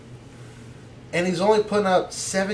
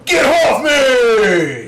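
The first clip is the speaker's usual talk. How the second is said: shouting; 7 semitones higher, 8 dB louder, much slower than usual. Acted. Team name shouted as catchphrase.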